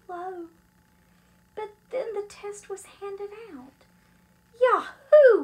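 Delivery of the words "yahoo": high, very loud whoop; yelled cheer. Acted. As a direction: yell it out with glee. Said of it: "we yelled".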